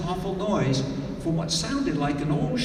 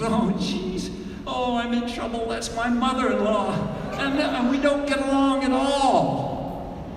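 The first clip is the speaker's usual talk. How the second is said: higher, panicky fretting voice; worried man; 8 semitones higher than usual. Retold or imitated. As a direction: squeak it out in dread. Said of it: "Bert recognized the woman and he says".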